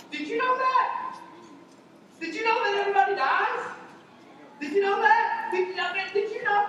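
preacher teasing the congregation; mock surprise. Shown as a demonstration. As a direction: feign shock at an obvious fact. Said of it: "mock surprise question".

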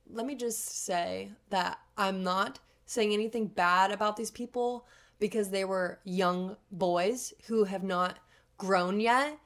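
ordinary talk, nothing marked

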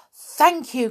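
high, loud thanks; grateful cry. A narrator's reading. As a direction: call out your thanks brightly. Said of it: "cried Binky".

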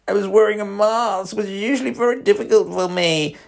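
high, loud mocking whine; mock the client's words as a whining snowflake; demeaning voice. Therapist reading client's text in a demeaning voice. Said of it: "that demeaning voice".

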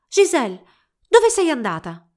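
high, loud call into the forest; desperate calling out. A narrator's reading. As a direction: call after someone who has vanished. Albert calls for vanished beloved.

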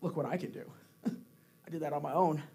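low, soft mock bragging; a hint of smug boasting; cocky. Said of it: "The cocky attitude".

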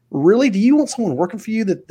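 high, disbelieving "Really?"; incredulous question. Retold or imitated. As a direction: challenge them with disbelief. Challenging people with a rhetorical question.

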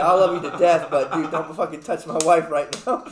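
loud, laughing mock threat; half-joking, laughing through the warning. Mock-protective warning to a friend.

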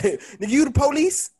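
high, excited rapper voice; mock the rapper's yelling; hype rapper impression. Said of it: "my favorite video is that".

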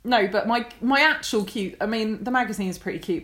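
normal talking voice

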